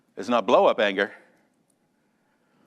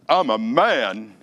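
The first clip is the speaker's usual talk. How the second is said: slow, heavy, mock-tough declaration; mock macho; 6 dB louder, slower than usual. Preacher acting a man excusing his anger, slow mock-tough. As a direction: say it slowly, like a helpless tough guy. Acted man's confession.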